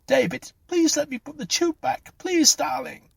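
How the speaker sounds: very high-pitched frantic begging; desperate pleading. A narrator's reading. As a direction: plead desperately for his life. Clara pleads with dying David.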